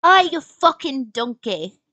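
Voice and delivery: a British-ish sneer on ah; attempted British accent. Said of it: "that doesn't sound British".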